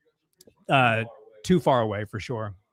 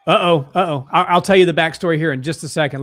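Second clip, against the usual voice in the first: alarmed excitement; 5 semitones higher, 7 dB louder than usual. Real, not acted. Fighter suddenly hurt.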